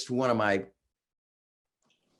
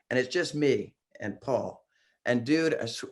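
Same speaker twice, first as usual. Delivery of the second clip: ordinary delivery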